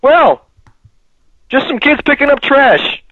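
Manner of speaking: high, breezy dismissive guard voice; easygoing guard. Retold or imitated. Security guard waving off the trash-taking kids, breezily. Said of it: "he's like".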